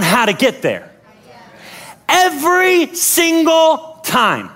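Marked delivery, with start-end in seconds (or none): none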